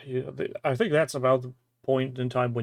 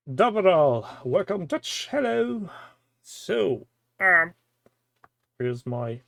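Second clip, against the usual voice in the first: muffled; 4 semitones higher, slower than usual. Real, not acted. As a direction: voice muffled behind a lens. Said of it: "if I sound muffled".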